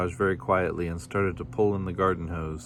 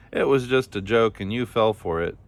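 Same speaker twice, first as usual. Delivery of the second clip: ordinary delivery, nothing marked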